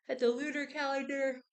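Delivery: talking through a real yawn; high, loud words stretched by a yawn. Real, not acted. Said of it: "I yawn every time".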